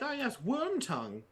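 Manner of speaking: high, mock-grand naming; airy mock recital; pompous. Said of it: "If I was like".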